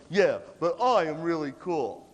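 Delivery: slow mock boasting; self-important. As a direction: imitate someone stuck on themselves, drawn out. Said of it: "one of those people who goes".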